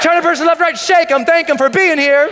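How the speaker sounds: high, loud shouted instructions; fired-up motivational holler; rallying the crowd. Real, not acted. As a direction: call out over a cheering room. Calling instructions over cheering crowd.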